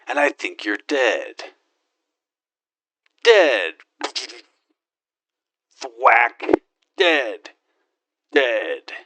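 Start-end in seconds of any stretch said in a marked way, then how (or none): 3.2-8.9 a loud, higher bark of a single word; battle shout. Acted. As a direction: yell it as you fire. Shouting while shooting.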